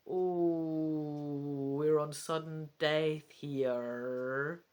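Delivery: ordinary delivery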